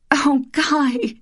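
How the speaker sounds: high, shaken cry of relief; high upset voice, relieved; tearful relief. Acted. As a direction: clutch at him after a nightmare. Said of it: "sobbing, catching her breath".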